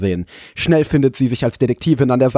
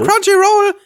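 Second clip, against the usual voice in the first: excited anime fan; 4 dB louder, slower than usual. Real, not acted. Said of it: "Ich war grad irgendwie Brain A F K".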